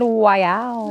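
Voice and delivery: "Wow" said as why and wow blended; "Wow" starting as "why", sliding into "wow". Retold or imitated. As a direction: stretch "Wow" into a why-wow hybrid. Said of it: "he invented a new word".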